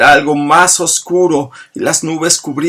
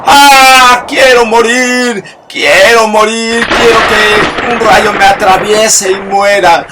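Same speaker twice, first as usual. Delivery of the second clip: loud, high wail of despair; anguished cry; 6 semitones higher, 6 dB louder than usual. A narrator's reading. Abandoned bride begging to die at midnight, anguished cry. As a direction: cry out to the heavens wishing for death.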